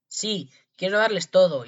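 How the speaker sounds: slow, high, very loud shout; shouting with all his might. A narrator's reading. Tom Thumb offers loot to the thieves, shouting. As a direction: yell it out slowly for all to hear. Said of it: "se puso a gritar con todas sus fuerzas".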